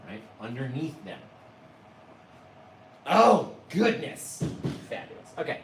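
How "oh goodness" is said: high, loud, slow oh goodness; a loud, drawn-out gasp of joy; delighted exclaim. Real, not acted. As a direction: burst out in delight. Delight at the painting.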